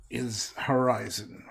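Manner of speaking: slow, lightly drawled cowboy accent on horizon; a hint of a lazy cowboy accent, stretched out. Shown as a demonstration. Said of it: "this is my cowboy accent".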